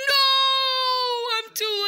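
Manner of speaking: loud, high anguished no; despairing cry. Acted. Boy learning someone else won, crying out loud. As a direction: wail it like all is lost. Loses the giveaway, cries out.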